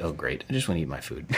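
low, muttered grumble under the breath; grumbling. Retold or imitated. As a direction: mumble it like a reluctant grump. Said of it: "at first I was like".

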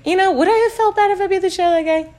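high-pitched, quick musing; mock self-questioning. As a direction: wonder aloud in a fast, high voice.

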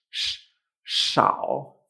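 SH hushed alone, then at the head of SHAO; emphasized sh. Shown as a demonstration. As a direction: lean on the hushing sh in SHAO. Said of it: "¿Notas ese sh".

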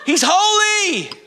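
high, ecstatic cry of praise; awed exclamation. Acted. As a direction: exclaim it as if struck by sudden revelation. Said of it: "The twenty-four elders go".

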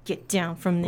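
quiet stern voice. Shown as a demonstration. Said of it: "Uh, and Rachel".

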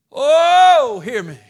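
high, loud wailed Oh, falling away; anguished cry. Acted. Preacher voices the psalmist's prayer, anguished cry. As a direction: cry out to God, then drop down. Crying out the psalm's plea to God.

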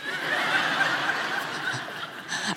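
high airless giggle; breathless laugh. Real, not acted. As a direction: let a silent giggle escape. Laughing at the confession.

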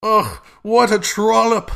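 high, loud, drawn-out snobbish disgust; hammed-up society disdain; bourgeois impression. Podcaster as snob judging Candace, exaggerated bougie voice. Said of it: "Bourgeois Impression".

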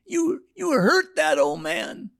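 high, slow mock old lady; hammed-up high old woman; scolding old woman. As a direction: wag a finger in a high old voice.

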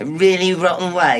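loud, high, incredulous repeat; mocking echo. Younger man echoing the insult, angry mockery. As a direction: throw the words back at him, outraged.

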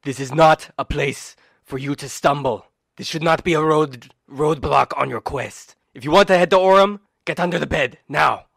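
lightly voiced Crit, pressing; urgent Crit. Crit ordering the player to hide, lightly voiced urgency. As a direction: urge them firmly, lightly in character.

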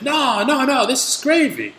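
high, loud mock protest; exaggerated stubborn insistence; insistent customer. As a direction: imitate a customer digging in, voice jumping up. Mimicking customer insisting it is gravy.

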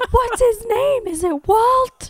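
loud, high, drawn-out fake question; mocking taunt. Imagined Ana Lucia mocking Michael over Walt, high drawn-out taunt. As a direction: stretch it into a hammed-up jeer.

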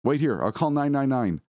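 anxious and urgent; urgent panic. Acted. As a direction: pitch up, taking charge in an emergency. Panicked wife going for help.

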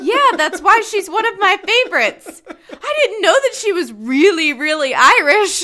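retelling her reply to Chris while laughing. Retold or imitated. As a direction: giggle through the retort. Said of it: "And I'm like".

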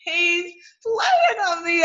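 high, drawn-out delight; playful squeal. Real, not acted. Preacher tickled by Jesus playing dumb, playful squeal. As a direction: stretch it out in a high amused cry.

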